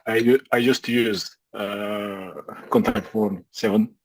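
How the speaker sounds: normal talking voice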